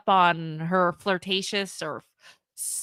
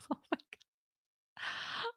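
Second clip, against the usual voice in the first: shocked whisper; 16 dB quieter, much slower than usual. Real, not acted. Reacting to an embarrassing text.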